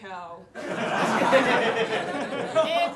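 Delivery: loud real laugh; laughing through えー. Real, not acted. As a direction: crack up. Laughing at own gag.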